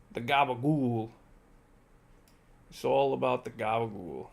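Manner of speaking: put-on light New Jersey Italian-American accent; Sopranos accent. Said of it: "Their accents".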